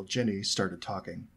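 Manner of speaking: ordinary delivery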